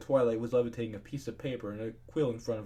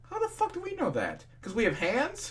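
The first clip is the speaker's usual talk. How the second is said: exasperated rant; 10 semitones higher than usual. Real, not acted. Ranting at commenters.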